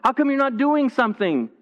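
high, panicked cry to a sleeping Jesus; panicked yelling. Retold or imitated. Disciples in the storm crying out to Jesus, high and panicked. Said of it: "and they're yelling".

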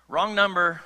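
loud, falling brush-off; mock wrong number. God brushing off Moses with a loud mock brush-off. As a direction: bark it like hanging up the phone.

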